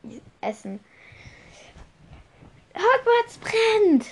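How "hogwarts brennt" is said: very high, drawn-out alarm cry; high shout to a whole hall. A narrator's reading. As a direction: raise the alarm, slowly and loudly. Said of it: "rief er".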